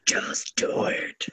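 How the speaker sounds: breathy urging whisper. Real, not acted. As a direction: whisper it to egg them on. Urging the gremlin voice.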